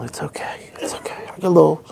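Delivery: whispered, choked up; tearful whisper. Real, not acted. Vlogger overcome with emotion watching clip, teary whisper. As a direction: whisper through tears. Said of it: "I get a little emotional".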